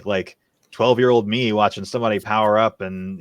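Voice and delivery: ordinary talk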